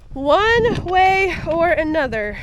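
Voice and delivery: slow, loud and resolute; determined. Real, not acted. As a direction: vow it, gritting through. Determined vow to reach summit.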